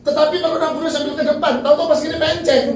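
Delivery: loud, high-pitched, heated preaching; worked-up scolding. Real, not acted. Preacher correcting the congregation's misunderstanding, worked-up and scolding. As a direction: raise your voice as if telling them off. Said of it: "Aku malah kok udah marah-marah".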